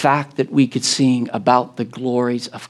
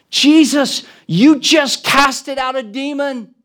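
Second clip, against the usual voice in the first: marvelling crowd; 9 semitones higher, 6 dB louder than usual. Acted.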